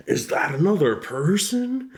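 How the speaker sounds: high, swooping mock anime actor; overplayed on-camera line read; dramatic filming impression. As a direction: ham it up, pitch climbing into the question. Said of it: "is that your best Tanjiro impression?".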